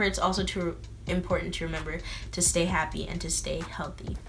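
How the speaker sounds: whispering. Real, not acted. Soft reassurance.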